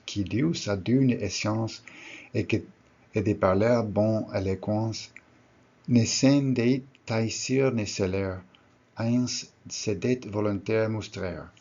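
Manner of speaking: put-on reading aloud in a self-admittedly clumsy Old French accent. Shown as a demonstration. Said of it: "excuse my terrible Old French accent".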